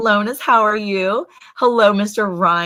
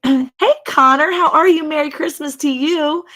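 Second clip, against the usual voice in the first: higher, louder cheery hello; welcoming a viewer on the live stream, higher; bright greeting; 9 semitones higher, 4 dB louder than usual. Real, not acted. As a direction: greet him with a lift.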